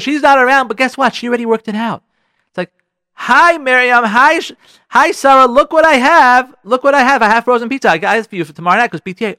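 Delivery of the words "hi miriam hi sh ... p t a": high, loud, eager husband; excited surprise. Acted. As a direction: proudly announce your surprise purchase.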